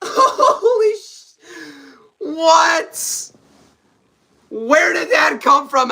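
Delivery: high laughing disbelief, slowed; shocked laughter. Real, not acted.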